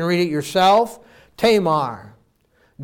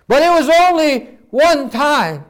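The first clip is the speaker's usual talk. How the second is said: high, loud, stammering desperate excuse; panicked pleading; 8 semitones higher, 7 dB louder than usual. Acted. Guilty husband pleading with the doctor, panicked. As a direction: blurt it out in panic, stumbling.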